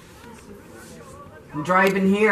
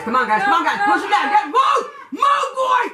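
from urging to a loud high yell; building cheer; 7 dB louder than usual. Real, not acted. Grandma urging the team, building to a shout.

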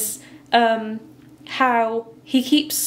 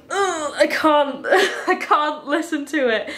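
higher, laughing cringe; mild disgust; 5 semitones higher than usual. Acted. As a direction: recoil with a half-laughing "ugh". Acting out her disgust at coughing.

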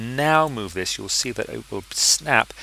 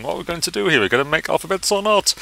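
lightly voiced impatient viewer, a touch higher; 7 semitones higher, 3 dB louder than usual. Tutor mimicking viewers tired of waiting, lightly voiced impatience. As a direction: mimic a bored student asking when the real work starts. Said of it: "we're six minutes in and thinking".

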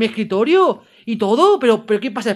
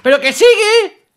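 high, loud, stretched cry; alarmed shout; 13 semitones higher, 10 dB louder, slower than usual. Real, not acted. Gamer being chased in the game, alarmed shout. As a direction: shout in disbelief that it keeps coming. Creature keeps chasing the player.

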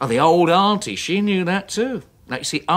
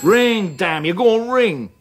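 loud, impatient call at the phone; will the phone to ring, louder; scolding the silent phone; impatient urging; 4 semitones higher, 5 dB louder than usual. Acted.

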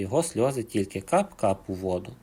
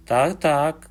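higher, louder furious cry; bear shouting; 5 semitones higher, 6 dB louder than usual. A narrator's reading. Bear answers the echo in the well, loud shout. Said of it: "крикнув ведмідь".

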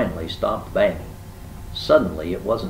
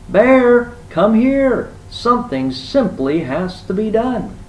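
high, loud call for help; urgent, high-pitched shout; 13 semitones higher, 8 dB louder, slower than usual. A narrator's reading. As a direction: holler for a friend up the hill. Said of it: "shouted Rabbit".

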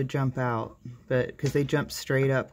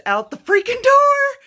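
high, loud disbelieving yell; exasperated shout; 12 dB louder than usual. Real, not acted. To viewers, yelling that the quail got out the door. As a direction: let the shock burst out.

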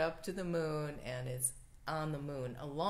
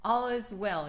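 All is well declared louder and firmly; firm affirmation; 4 semitones higher, 8 dB louder than usual. Shown as a demonstration. Minister repeating the phrase with conviction, louder. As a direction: put your conviction behind All is well. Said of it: "You wanna say that again?".

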